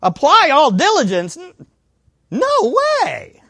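high-pitched, hammed-up disbelief, jumping up on the refusal; mock incredulous refusal. Preacher playing a believer refusing to make effort, mock incredulous. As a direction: balk at it in an exaggerated high voice.

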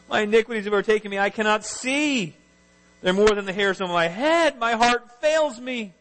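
high-pitched lament, stretched at the ends; anguished prayer. Acted. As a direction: recite it as an overwhelmed cry. David's desperate prayer acted out.

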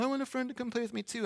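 a hint of a pleading small child; five-year-old. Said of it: "then the five-year-old's gonna".

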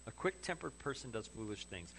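knowing, understated repeat; wry repeat. Shown as a demonstration. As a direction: let the point land with a dry nudge. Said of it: "You think?".

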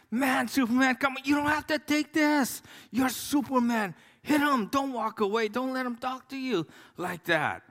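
high, pleading mock protest; pleading with the screen. Pastor watching the movie, urging Superman in a high pleading voice. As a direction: beg Superman not to walk away. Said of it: "I'm going".

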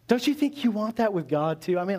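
ordinary talk, nothing marked

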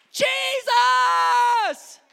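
high, long, loud cry; desperate shout. Acted. Blind beggar ignoring the crowd, shouting. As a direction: holler the name over the objectors. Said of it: "he begins shouting".

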